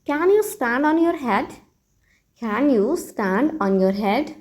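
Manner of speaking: very slow, teacherly model phrase; slow model sentence. Shown as a demonstration. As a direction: spell it out slowly for learners. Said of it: "तो इस बात को आप English में कहिए". (बहुत धीमे, सिखाने वाले अंदाज़ में)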